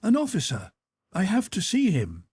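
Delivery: lightly voiced courteous young officer; pleasant well-bred voice. A narrator's reading. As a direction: speak with easy good manners. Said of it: "in a pleasant, well-bred Russian voice".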